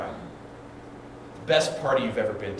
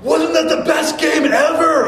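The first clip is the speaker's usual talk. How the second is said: high, loud, slow overjoyed yell; brother's excited shout; 10 dB louder than usual. Retold or imitated. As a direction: shout it like the best game ever. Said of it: "my brother calls me up".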